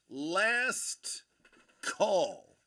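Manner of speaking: each word called out loud and separate, Last rising, call falling; drawn-out last call. Shown as a demonstration. Streamer calling out to chat, loud separated call. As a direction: stretch Last and call apart like a bell ringer.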